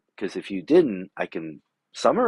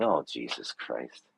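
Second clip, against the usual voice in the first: low, slow, soft "Oh, Jesus Christ"; weary disbelief; exasperated sigh; 11 semitones lower, 8 dB quieter, slower than usual. Retold or imitated. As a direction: let it sink out of you, fed up. Exasperated reply.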